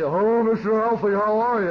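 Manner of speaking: loud, fawning voice of the jerk who dates his daughter; sarcastic imitation of a suitor sucking up to the father; mock suitor greeting.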